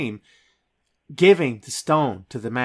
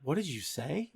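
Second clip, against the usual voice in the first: hushed disbelief; 10 dB quieter than usual. Retold or imitated. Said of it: "she's like".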